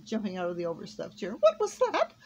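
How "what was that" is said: high, loud, alarmed question; startled gasp. A narrator's reading. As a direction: demand it in sudden shock. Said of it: "she gasped".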